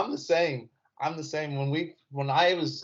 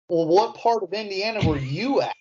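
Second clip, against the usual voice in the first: higher, louder pointed question; pointed comeback; 6 semitones higher, 3 dB louder than usual. Retold or imitated. Answering the condescending girl, a pointed comeback. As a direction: turn the question back on her. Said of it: "I go".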